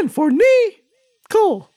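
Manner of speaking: slow, very high cartoon falsetto; Nikki Moss. Cartoon correspondent explaining the letter in his name, slow high falsetto. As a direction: spell it out slowly in a squeaky voice. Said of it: "this is Nikki Moss".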